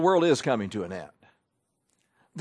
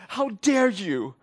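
higher, drawn-out mock indignation; imitated near-bawling; 5 semitones higher, slower than usual. Preacher mimicking a teenage activist's outburst, mock near-bawling. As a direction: imitate someone almost bawling with outrage. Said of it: "like she was ready to start bawling".

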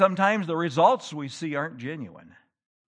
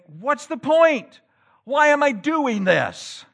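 higher, louder, slow exasperated cry; imitated weary despair; 10 semitones higher, 8 dB louder, slower than usual. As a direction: sound like a worker ready to quit. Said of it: "When you have a day and you think".

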